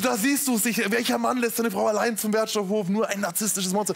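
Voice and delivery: high-pitched, hammed-up lament; melodramatic self-reproach. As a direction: lament theatrically into the phone. Spielt dramatisches Telefonat mit Frau nach. (theatralisch jammernd)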